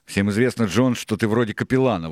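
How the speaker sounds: loud argumentative comeback; Israel, objecting. A narrator's reading. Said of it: "возразил ему Израил".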